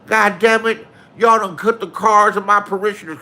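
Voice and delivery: a loud, high, ranting preacher. Retold or imitated. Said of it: "He said".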